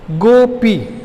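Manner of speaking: "Gopi" in two long, separate beats; split into syllables. Shown as a demonstration. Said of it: "उंगली पर बोलिए".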